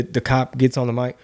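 ordinary talk

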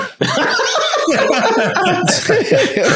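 long high cackling laugh; helpless laughter. Real, not acted. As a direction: burst out laughing at a disastrous roll. Laughs at rolling a one.

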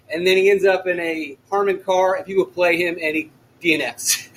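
normal talking voice

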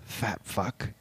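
very soft, low mutter; muttered under breath. Retold or imitated. Reporter slipping an insult at Rob Ford, muttered under his breath. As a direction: mutter it under your breath, barely audible. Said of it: "the reporter mutters under his breath".

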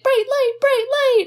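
high little creature voice; put-on tiny high-pitched plea; imitating Gizmo. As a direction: squeak it like a tiny, cute creature. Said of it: "And he's like".

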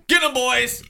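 high, loud battle cry; sudden full shouted order; shouted command. Retold or imitated. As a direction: yell it to send the gang charging. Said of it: "I said".

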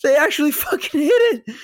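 very high, loud shocked outburst; freaking out. Retold or imitated. Film fan reliving the stroller crash, freaking out. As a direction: reenact a stunned viewer yelping in disbelief. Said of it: "I was freaking out too".

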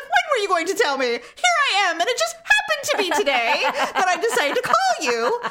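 hammed-up, high-pitched wounded protest; jilted lover. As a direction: play the dumped lover, half laughing. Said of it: "I feel like a jilted lover".

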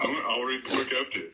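mock crying. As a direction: fake sob through the words. Mock sobbing at a joke.

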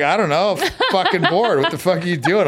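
high, laughing, loud goofing around; laughing bored retort. Retold or imitated. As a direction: laugh it off to a friend at 2am.

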